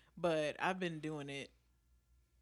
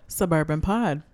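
loud intro; 15 dB louder than usual. Acted. Podcast intro announcement.

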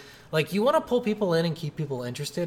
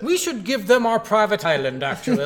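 loud, high-pitched mock snob; pompous rich man; 5 semitones higher, 4 dB louder than usual. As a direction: pronounce it with hammed-up pomposity. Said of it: "like, all pompous and shit".

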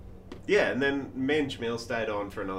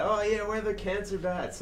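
lightly hammed Canadian accent, much higher; a hint of a cheery Canadian introduction in a Canadian accent; bad Canadian accent; 11 semitones higher than usual. Canadian band introducing themselves, mock Canadian accent. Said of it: "Speaking shit Canadian accents".

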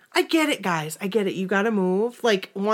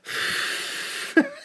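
breathy huffing noise; 5 dB quieter than usual. Retold or imitated. Imitating breathing on face.

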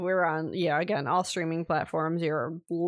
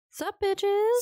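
high-pitched, cocky hello; brash mock greeting; 13 semitones higher than usual. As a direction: toss it out like a cheeky shout.

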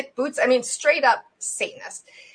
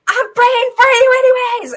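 high, loud holler across a store; screamed; 13 semitones higher, 10 dB louder than usual. Retold or imitated. Woman calls after the Satanist through the store, screaming. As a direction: yell it after someone walking away. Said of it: "I screamed through the store".

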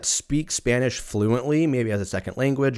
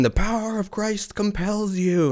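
high, lightly hammed exorcist chant; mock exorcist; 10 semitones higher than usual. Fishburne playing exorcist in mashup, mock solemn chant. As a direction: intone it like a movie priest. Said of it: "Lawrence Fishburne comes in".

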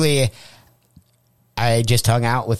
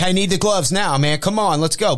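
impatient glove collector; 8 semitones higher than usual. Retold or imitated. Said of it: "And he's like".

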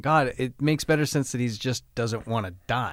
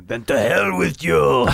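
Crom voice; 3 semitones higher, 6 dB louder, slower than usual. Acted. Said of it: "fill it in with the Crom".

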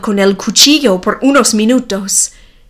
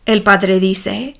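plain reading voice

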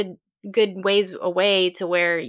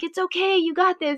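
higher, calling back encouragement; lightly voiced friend cheering her on; encouraging call; 10 semitones higher than usual. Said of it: "he was calling back to me".

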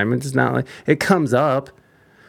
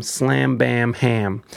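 ordinary talk

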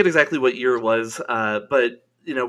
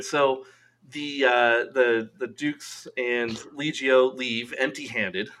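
ordinary talk, nothing marked

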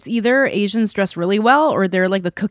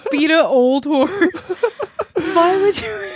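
higher, slow, laughing disbelief; 8 semitones higher, slower than usual. Real, not acted. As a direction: laugh at the botched idiom. Mocking the mixed-up phrase, laughing.

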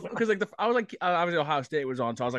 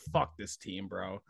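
disgusted mutter; 7 semitones lower, 9 dB quieter, slower than usual. Retold or imitated. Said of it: "I was like".

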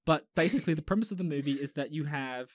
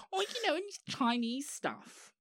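much higher, softer put-on woman's voice; a breezy, high mock of the acquaintance; mocking imitation; 10 semitones higher, 5 dB quieter than usual. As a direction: parody her airy cluelessness. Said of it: "And she goes".

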